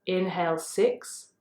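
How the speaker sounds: slow, low, soft counting with long pauses; guided breath count. Real, not acted. Yoga teacher counting listeners' breaths in and out, guided breath count. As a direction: count the breaths down slowly, voice dropping low on the numbers.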